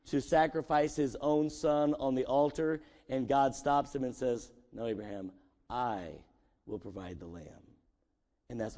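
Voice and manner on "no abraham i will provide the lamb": low, hushed voice of God staying Abraham's hand. Acted. God stopping Abraham at the altar, low and hushed. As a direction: speak low and soft, a solemn voice from above.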